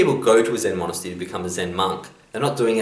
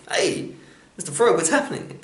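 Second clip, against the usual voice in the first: higher, casual critter voice; a laid-back bug saying hi; friendly caterpillar; 10 semitones higher than usual. Acted. Caterpillar greeting the frog, higher and casual.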